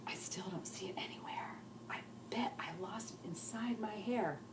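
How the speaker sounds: soft voiceless whisper; girl hunting for her lost voice, whispering softly. Acted. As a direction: whisper as if your voice is gone. Lucy searches for lost voice.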